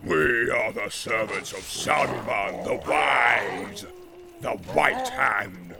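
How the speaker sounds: loud, slow boastful roar; roaring orc warlord. Acted. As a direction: boast like a war chief. Ugluk boasting, shouting.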